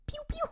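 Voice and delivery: high pew pew; chick sound. Shown as a demonstration. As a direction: peep like a chick. Chick noise French.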